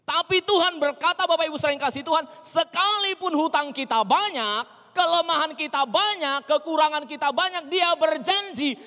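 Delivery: high, loud preacher's proclamation; preaching at full force. Real, not acted. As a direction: proclaim the promise at full volume, easing at the very end. (khotbah berapi-api, lantang)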